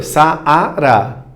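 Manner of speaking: "Saara" slowly in three distinct blocks; syllable by syllable. Shown as a demonstration. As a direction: sound out "Saara" as Sa-a-ra. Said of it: "Eu pronunciei três blocos".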